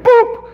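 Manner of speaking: high, sudden boop; imitated boop. Lights go out on cue, comic high boop. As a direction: pop out a quick high sound effect.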